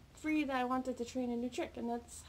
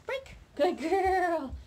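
high, loud praise burst; excited dog praise; 7 semitones higher, 6 dB louder, much slower than usual. Real, not acted. Trainer cheers her puppy's success loudly.

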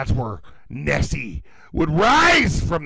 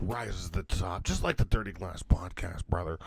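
much lower rumbling promo line; low mock wrestler growl; 8 semitones lower, 6 dB quieter than usual. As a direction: growl it down at the camera.

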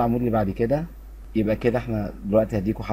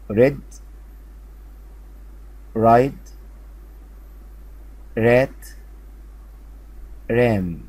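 words called out louder, long pauses to echo; loud drill; slower than usual. Shown as a demonstration. Teacher reads the column with pupils, loud and clear. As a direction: lead the class through the column, loud. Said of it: "نقرا بقى العمود ده مع بعض".

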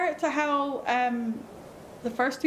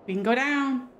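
normal talking voice

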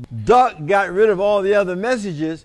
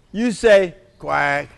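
ordinary delivery

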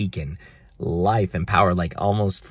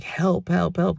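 high panicked cries of help; screaming for help; 13 semitones higher, slower than usual. Retold or imitated. Running from him in the dream, screaming for help. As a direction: yell for help in terror. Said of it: "we start screaming".

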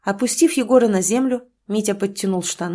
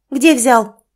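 angry demand; 4 semitones higher, 7 dB louder than usual. Acted. Mother interrogating son.

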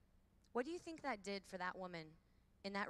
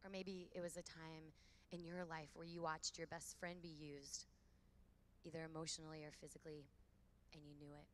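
guided reflection; 2 semitones lower, 6 dB quieter than usual. Acted. Speaker guiding women with eyes closed, in a soft reflective voice. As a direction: lead a quiet eyes-closed reflection, gently.

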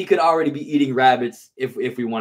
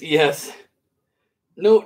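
ordinary delivery

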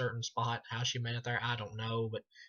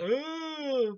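high-pitched mock scream, held long; mimicking a screaming film villain; imitated Leatherface scream; 6 dB louder than usual. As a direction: wail out a loud, high "ah" as a panicking movie killer. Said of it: "he's just screaming like".

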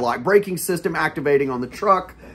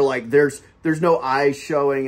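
usual speaking voice

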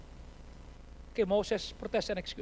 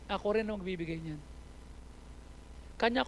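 ordinary talk, nothing marked

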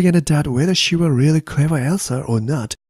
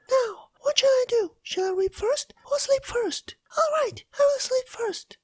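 high, soft tearful voice; crying Elsa; 5 dB quieter than usual. Acted. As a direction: whimper it out, close to tears. Elsa dithers again.

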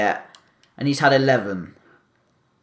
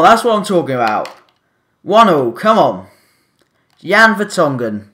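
ordinary talk, nothing marked

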